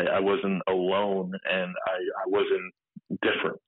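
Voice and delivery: slow, tearful words breaking through; real tears, pushing each word out slowly; choked up telling the group he wasn't alone; crying. Real, not acted. Emotional about finding support group.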